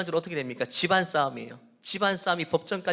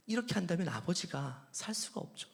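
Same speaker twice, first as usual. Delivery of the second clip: usual speaking voice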